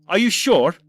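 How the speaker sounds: loud, high, pointed challenge; sceptical challenge. Acted. Doctor challenging his earlier self, loud and doubtful. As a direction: push back hard with doubt.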